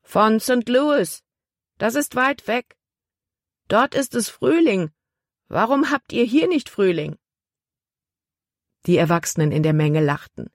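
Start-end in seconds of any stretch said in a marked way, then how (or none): 0.0-7.2 put-on a curious girl shouting up to the deck; young girl calling. A narrator's reading. Girl calls to the boat. (hohe, kindliche Mädchenstimme)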